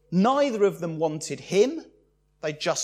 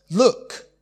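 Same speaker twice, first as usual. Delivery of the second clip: "Look" barked loudly at a father; loud insolent "Look"; rude "Look"; 5 semitones higher, 8 dB louder than usual. Acted. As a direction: demand attention with a disrespectful "Look". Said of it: "Instead, he says".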